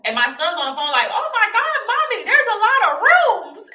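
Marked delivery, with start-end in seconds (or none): 1.1-3.7 put-on very high, loud kid squeal; excited son. Her son on the phone seeing rooms, squealing excitedly. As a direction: imitate a child exclaiming at full excitement.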